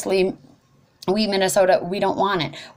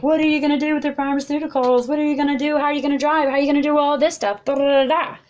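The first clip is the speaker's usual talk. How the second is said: high, fast mocking voice of the doubters; hammed-up skeptic voice rattling off questions; mocking questioner; 9 semitones higher, faster than usual. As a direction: parrot their questions back, pitched way up and quick. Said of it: "I get asked the question, like".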